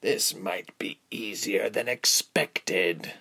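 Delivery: slow, mocking villain; drawn-out sneering pity; derision. A narrator's reading. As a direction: savour her fear with scorn. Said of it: "said with derision".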